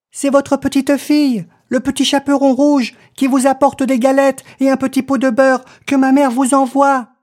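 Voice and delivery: put-on high, quick, innocent child; little girl voice. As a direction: chirp it like a trusting little girl. Girl answers at door.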